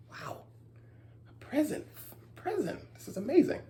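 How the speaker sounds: unvoiced whisper of delight. Storyteller whispering to the kids about the present. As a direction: hush it like a secret treat.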